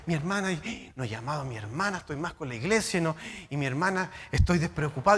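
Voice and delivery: musing to oneself. Retold or imitated. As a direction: mutter a guilty inner thought. Imagined self talk, guilty.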